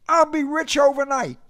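high, loud boastful declaration; declaring. A narrator's reading. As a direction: announce it like a sure thing. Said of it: "Boris declared".

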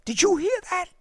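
plain reading voice

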